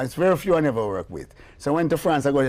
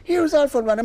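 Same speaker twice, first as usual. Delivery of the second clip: high, louder proud announcement; excited announcing; 6 dB louder than usual. Retold or imitated. The lady presents the singer, high and excited. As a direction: present the star with glee. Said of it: "she say".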